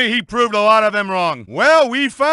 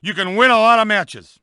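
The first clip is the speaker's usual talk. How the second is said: ordinary talk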